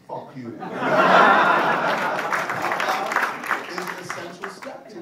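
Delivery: tossed off through a laugh; laughing curse. Real, not acted. Defending his flop to the crowd, laughing as he curses. Jokingly cursing at critics.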